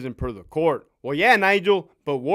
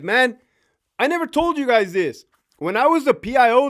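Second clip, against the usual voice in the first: ordinary delivery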